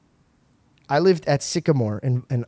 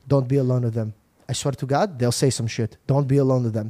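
imitating mother; 4 semitones lower than usual. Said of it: "My mother would raise me like this".

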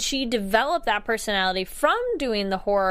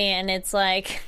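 ordinary delivery

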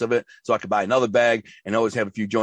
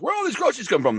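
high, suspicious dad's question; imitating his dad; 12 semitones higher, faster than usual. Dad asking his son about groceries, high suspicious tone. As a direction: play the dad eyeing the groceries, voice jumped up.

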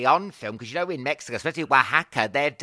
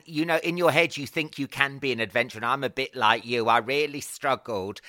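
usual speaking voice

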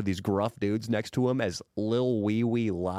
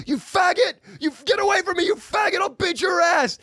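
very high, loud mock rage; furious screaming; 6 dB louder than usual. Angry taxi guy screams threats at the prankster, high mock rage. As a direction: scream insults at the top of your lungs. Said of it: "He's like".

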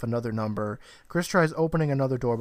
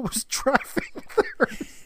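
laughing; 11 semitones higher, slower than usual. Real, not acted. Recapping a plot twist.

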